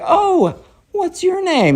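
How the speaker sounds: very high, slow, lilting greeting; sweet mom voice. Acted. Mom asks the strange kid his name, sweetly. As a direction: coo it high and drawn out.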